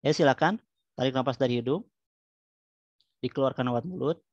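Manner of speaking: calm guided breathing voice; soothing spa whisper. Acted. Leads relaxation therapy.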